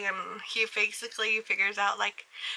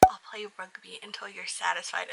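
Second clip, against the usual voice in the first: hushed, whispered vow; 3 semitones lower, 8 dB quieter than usual. Retold or imitated. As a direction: whisper it like a secret promise. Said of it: "Like, the way they whisper it".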